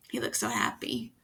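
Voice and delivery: soft pleased whisper. Real, not acted. Reader to the child about the picture, a soft whisper. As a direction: breathe it gently.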